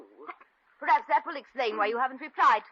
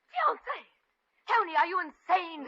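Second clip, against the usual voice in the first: high-pitched, incredulous outburst; shocked disbelief; 7 semitones higher than usual. Acted. Rival hearing of the engagement, shocked disbelief. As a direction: shoot the pitch up in outrage.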